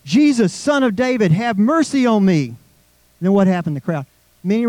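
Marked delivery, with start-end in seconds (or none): 0.0-2.5 high, raised cry for mercy; shouted plea. Retold or imitated. Blind man calling out to Jesus, raised cry. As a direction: call out to Jesus, pitched high. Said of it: "he began to shout".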